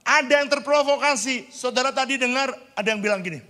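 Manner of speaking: loud, high, emphatic pulpit voice; full-force preaching. Real, not acted. Preacher challenging those provoked by the story, loud high preaching. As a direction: hammer it out to the congregation.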